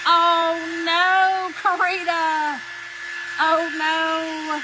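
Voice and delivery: high, loud mock dismay. Real, not acted. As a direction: react in playful horror. Reacting to viewer comment.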